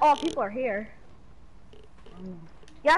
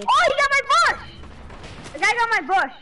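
raging gamer; 9 semitones higher, much faster than usual. Real, not acted. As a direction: scream at losing your cover. Enemy destroys his cover.

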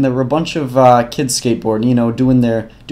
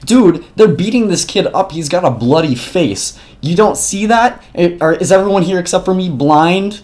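raised, higher indignant protest; outraged disbelief; 7 semitones higher, 4 dB louder than usual. Retold or imitated. Narrator retelling his protest to bystanders, outraged and higher. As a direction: demand how nobody else sees it.

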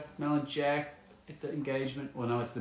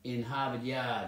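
r-less, put-on Boston accent; 3 dB quieter than usual. Shown as a demonstration. Host showing off an attempted Boston accent. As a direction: finish the stock Boston accent phrase. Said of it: "Boston accent?".

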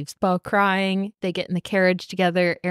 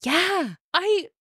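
high-pitched squealing agitation; overwhelmed fangirl; 12 semitones higher than usual. Real, not acted. Reader who knows what's coming, overwhelmed high squeal. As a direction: speak up high, breaking off into a squeal. Overcome by the book's plot.

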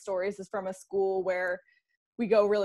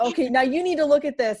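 excited; 5 semitones higher, 5 dB louder than usual. Retold or imitated. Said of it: "they'll grab a friend".

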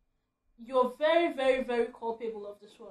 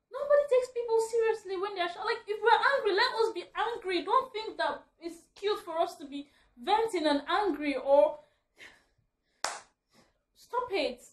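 loud and higher, venting hard; angry rant; 8 semitones higher, 7 dB louder than usual. Real, not acted. Short woman ranting loudly to the camera. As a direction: let the frustration burst out loud. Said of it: "It's a rant video".

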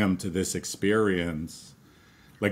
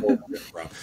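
laughing; 12 semitones higher, faster than usual. Real, not acted. Laughing echo of partner.

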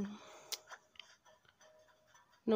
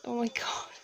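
awed whisper. Real, not acted. Overwhelmed at finding villager.

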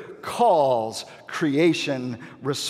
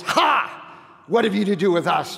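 sudden high burst, then a rushed challenge; mock yell; 5 semitones higher, 3 dB louder than usual. Demon under pressure confronts Jesus, yelling in imitation. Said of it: "and he yells".

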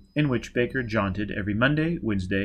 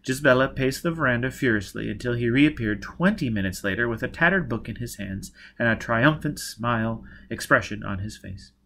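usual speaking voice

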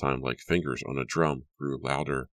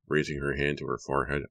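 plain reading voice

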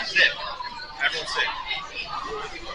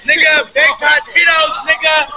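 excited shouting; 6 semitones higher, 5 dB louder than usual. Real, not acted. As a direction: belt it out, hyped. Excited shouting food list.

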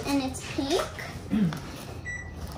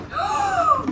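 excited gasp. Real, not acted. Unboxing surprise.

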